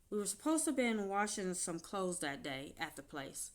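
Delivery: ordinary talk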